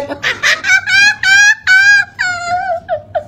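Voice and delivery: long high laugh; real amused laughter; long cackle. Real, not acted. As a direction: howl with laughter. Laughing at viewer reactions.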